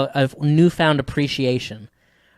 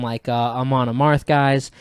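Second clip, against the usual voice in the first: usual speaking voice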